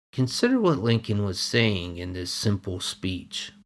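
normal talking voice

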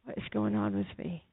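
hushed inner plea to Jesus; silent prayer. Retold or imitated. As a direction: pray it as if only in your head. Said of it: "in my head".

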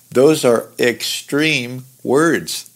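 slowed right down, each word weighted; slow emphasis. Real, not acted. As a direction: lean hard on extreme, slowly. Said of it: "the max".

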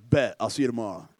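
low, offhand man's reply; Samson. Retold or imitated. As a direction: drop the pitch and agree coolly. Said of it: "Samson's like".

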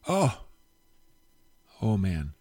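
ordinary talk, nothing marked

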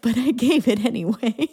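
laughing through the words. Real, not acted. Laughing at her own heroic death. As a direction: giggle it out at yourself.

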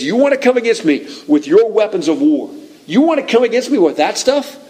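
high-pitched, incredulous challenge, rising at the end; defiant challenge. Acted. David faces Goliath's weapons of war, defiant challenge. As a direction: throw it back at the enemy, daring him.

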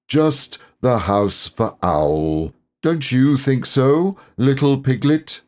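slow, wavering between laughing and not; holding back a laugh; Christopher Robin, amused. Acted. Christopher Robin teasing Piglet, a hint of suppressed amusement. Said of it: "wondering whether to laugh".

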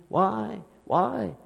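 small, soft, repeated plea; soft questioning. Acted. Preacher voicing someone questioning Christ's work, softly pleading. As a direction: ask softly, as if doubting. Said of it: "but, like, questioning".

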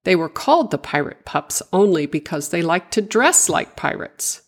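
ordinary delivery

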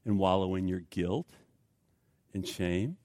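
low, soft, tender counsel; hushed fatherly reassurance; God speaking gently. Retold or imitated. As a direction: speak softly, with pauses, to a guilty man. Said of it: "God says to me".

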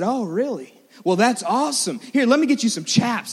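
mildly hyped-up retold friend; impressed enthusiasm. Cool cowboy friend welcomes a new rider, enthusiastic.

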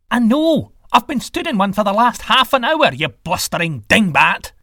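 high, loud exasperated retort; irate god losing patience; angry sky god. Acted. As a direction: hurl back an indignant complaint. Sky god snaps back with insult.

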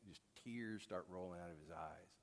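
ordinary talk, nothing marked

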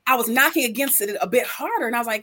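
normal talking voice

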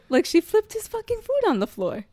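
high-pitched, incredulous outrage; indignant disbelief. Real, not acted. Telling a friend about the flipped food, high indignant disbelief. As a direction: voice shoots way up in disbelief.

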